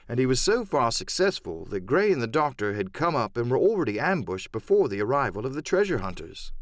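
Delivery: ordinary delivery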